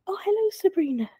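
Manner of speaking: a high, lightly Scottish greeting; a hint of Scottish lilt in a cheery hello; Scottish accent. Friend imitates Michelle Gomez greeting her, a hint of Scottish. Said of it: "I can't do a Scottish accent, but she was like".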